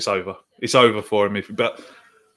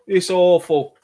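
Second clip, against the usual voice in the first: ordinary talk, nothing marked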